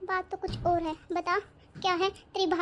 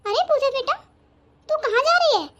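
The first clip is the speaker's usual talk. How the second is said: calling out; 6 semitones higher, 6 dB louder than usual. Acted. Aunt calls out to Pooja.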